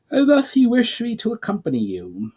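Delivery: goblin voice. A narrator's reading. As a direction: pitch it up like a goblin emissary offering help. Goblin explains orders.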